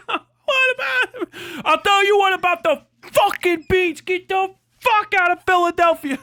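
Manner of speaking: high, loud mock yell at the busker; angry Philly local. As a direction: scream him out of town like an outraged Philly fan.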